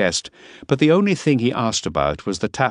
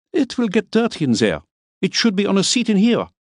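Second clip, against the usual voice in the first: Miller; 14 semitones higher, 3 dB louder than usual. A narrator's reading. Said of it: "fussy Miller voiced".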